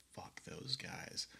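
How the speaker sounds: hushed, slow contempt under the breath; muttered scorn. Retold or imitated. Grad students sneering at the band, hushed scorn. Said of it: "we were just like".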